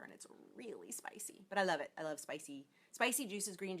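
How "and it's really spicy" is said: breathy whisper, no voice. Real, not acted. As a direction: whisper it like a secret. Whispered aside about spice.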